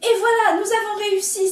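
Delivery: high, loud triumphant; happy cry. A narrator's reading. Rooster at the cliff top, high loud joy. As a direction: shout it with joy. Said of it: "s'écrie le petit coq tout heureux".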